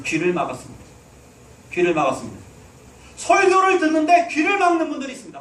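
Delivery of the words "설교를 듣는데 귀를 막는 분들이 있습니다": much higher, loud shouted rebuke; preaching shout. Real, not acted. Pastor scolds listeners who shut their ears, shouting. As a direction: call it out hard at the congregation.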